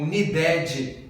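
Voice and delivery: "needed" with a Brazilian ending, nee-DÉ-dji; wrong "ed" sound. Shown as a demonstration. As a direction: add a "dji" tail to "needed". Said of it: "o som não é ed". ("needed" errado, terminando em "dédji")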